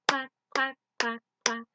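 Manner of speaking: loud sung duck quacks. Acted. Teacher singing mother duck's quacks loud.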